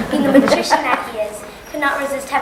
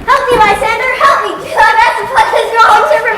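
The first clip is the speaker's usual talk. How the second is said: frightened cry; 7 semitones higher, 5 dB louder than usual. Acted. Waking from a nightmare.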